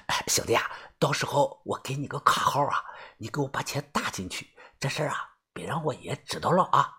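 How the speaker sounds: very soft, secretive murmur; hushed confiding. Acted. Buddy hiding money from his grandfather, hushed confiding. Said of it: "他搂着我小声地说啊".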